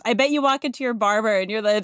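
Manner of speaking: normal talking voice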